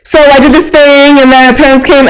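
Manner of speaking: breezy skimming. Retold or imitated. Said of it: "I was like".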